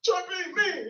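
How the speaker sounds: high-pitched, whining fake tears; hammed-up tearful complaint; mock crying. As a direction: imitate a man starting to cry mid-argument. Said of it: "He starts, like, crying".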